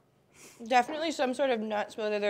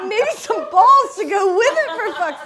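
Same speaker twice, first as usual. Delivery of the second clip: high, loud laughing complaint; laughing outrage; 11 semitones higher, 15 dB louder than usual. Real, not acted. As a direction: yell it while cracking up. Disgusted by the spray taste.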